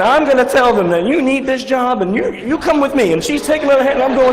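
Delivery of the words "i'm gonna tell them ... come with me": high, louder imitation of a bossy helper; hammed-up insistence. Receptionist dragging a jobseeker to his interview, mock insistent. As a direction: press the point, pitched right up.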